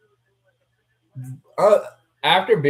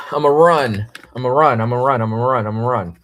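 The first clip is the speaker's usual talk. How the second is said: loud call fading; 7 dB louder than usual. Real, not acted. Repeated announcement, fading.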